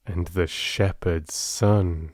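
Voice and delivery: hushed final word; whispered. A narrator's reading. Narrator closes the grim fable, whispered. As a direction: let the ending drop to a whisper.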